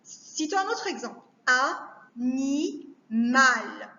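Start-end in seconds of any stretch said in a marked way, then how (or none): 2.2-3.9 "Animal" very slowly as A... ni... mal; three separate beats in "Animal", long pauses between; split into syllables. Shown as a demonstration. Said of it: "couper les mots en syllabes".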